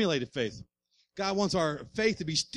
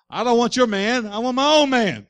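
emphatic declaration; 5 semitones higher, 6 dB louder than usual. Acted. Acts out women praying, crowd-rousing.

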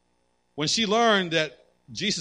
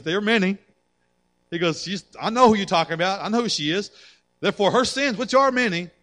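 higher and louder, climbing with each phrase; building fervor; 7 semitones higher, 7 dB louder than usual. Acted. Pastor voicing Jesus to Simon, rising fervor. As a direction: rise up in passion as Jesus.

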